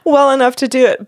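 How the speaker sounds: ordinary talk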